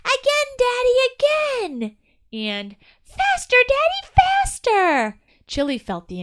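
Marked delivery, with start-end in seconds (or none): 0.0-2.0 put-on high, loud child's yell; a little boy begging for more; boy shrieking with delight at his dad. A narrator's reading. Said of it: "Chili heard the boy yell". 3.0-5.2 put-on high, loud child's yell; a little boy begging for more; boy shrieking with delight at his dad. A narrator's reading. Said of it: "Chili heard the boy yell".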